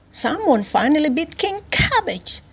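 high-pitched jubilant exclamation; cheering. A narrator's reading. Prisoners celebrating King Cabbage's defeat, cheering. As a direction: exclaim it with high, gleeful relief. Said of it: "cheered the prisoners".

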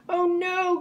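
high-pitched cry of dismay; alarmed cry. A narrator's reading. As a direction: cry out as disaster looms. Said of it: "cried the ringmaster".

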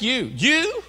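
"you" thrown out high and loud as a question; emphatic YOU. Acted. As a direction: punch "you" in rising disbelief. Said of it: "it's an emphatic".

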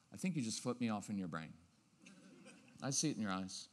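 low, soft and dry; fast, understated calm; low-key tone. Shown as a demonstration. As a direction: lightly tease the kid, calm. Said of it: "this low-key tone is really cool".

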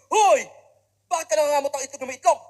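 loud, high shout at a pickpocket; angry yell. Retold or imitated. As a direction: yell in outrage, fast. Yelling at a pickpocket. (sigaw sa mandurukot, malakas at galit)

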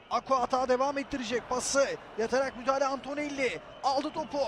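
normal talking voice

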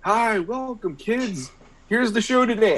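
put-on high bright kids' show host voice, far above his own; cartoon host. Cartoon host welcoming kids to the show, high and cheery. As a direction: greet the kids like a peppy TV host. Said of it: "and he'd say".

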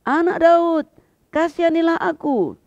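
high, loud insistent plea. A narrator's reading. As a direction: shout over the people hushing him. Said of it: "semakin keras ia berseru".

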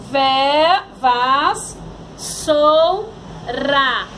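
"vas, sou, ra" as loud isolated syllables; sílabas separadas. Shown as a demonstration. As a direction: leave a gap after each piece of "vas, sou, ra". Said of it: "separação de palavras". ("vas, sou, ra" sílaba por sílaba, forte)

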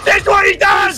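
very high, excited victory yell; triumphant shout. Real, not acted. Teammate hailing the winning player, triumphant shout. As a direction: yell it in celebration. Team celebrates winning the game.

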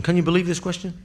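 normal talking voice

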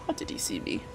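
baffled whispered question. Real, not acted. Gamer puzzled after being spotted, whispering. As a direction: breathe it under your breath.

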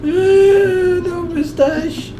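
stretched-out silly voice; slow high character voice. Acted. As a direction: drag it out in a funny voice. Character voice while playing.

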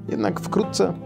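ordinary delivery

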